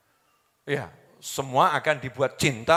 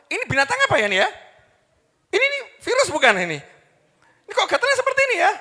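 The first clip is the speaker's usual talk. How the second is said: high, loud, rapid panicked questions; mock alarm; 7 dB louder, much faster than usual. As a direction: rattle it off in hammed-up alarm. Acts out alarmed itchy person.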